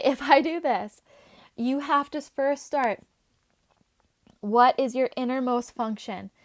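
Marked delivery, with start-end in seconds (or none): none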